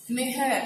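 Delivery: नेहर with a long 'e' first, 'ne-har'; wrong pronunciation. Shown as a demonstration. Teacher showing the mistake people make in writing, wrong pronunciation. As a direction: stretch नेहर's first vowel into 'neh'. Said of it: "लोग इसको लिख देते हैं नेहर".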